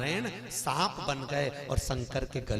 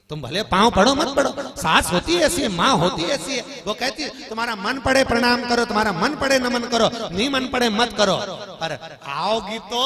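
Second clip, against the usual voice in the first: loud, high-pitched sermon crescendo; high, booming exhortation; full-force preaching; 9 semitones higher, 15 dB louder than usual. Acted. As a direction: drive it home loudly to the congregation. Preaches on mothers-in-law at full force.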